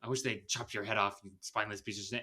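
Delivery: a fast rushed mouthful; crammed in fast. Shown as a demonstration. Podcaster imitating actress cramming the line in, fast. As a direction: rattle it off quickly in one breath. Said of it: "cram it in really quickly".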